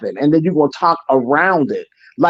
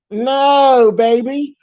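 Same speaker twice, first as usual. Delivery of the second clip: emphatic no; 6 semitones higher, 4 dB louder, much slower than usual. Real, not acted. Rebutting the relatives with force.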